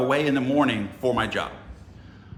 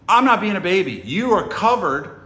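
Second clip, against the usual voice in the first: raised, loud comeback, slowing at the end; indignant retort; 7 semitones higher, 6 dB louder than usual. Retold or imitated. Visitor answering "don't be a baby", indignant. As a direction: replay a heated protest. Acted quarrel over being a baby.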